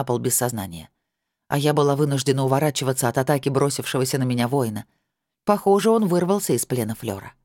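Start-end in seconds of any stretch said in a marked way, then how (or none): none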